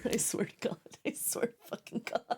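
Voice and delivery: breathy muttered oath; half-laughing hushed exasperation; exasperated whisper. Real, not acted. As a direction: swear under your breath.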